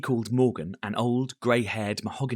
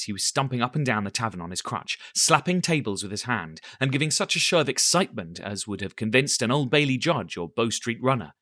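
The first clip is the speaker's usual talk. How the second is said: plain reading voice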